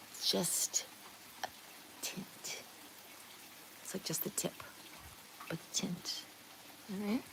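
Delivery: whisper. Real, not acted. Whispering about hair tint.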